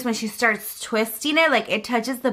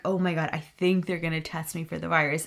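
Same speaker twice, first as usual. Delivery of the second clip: lower, softer, worried aside to herself; scared; 5 semitones lower, 7 dB quieter, faster than usual. Retold or imitated. Mom hearing the nurse suit up for a swab test, hushed dread. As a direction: say it under your breath as fear creeps in. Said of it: "And I'm scared".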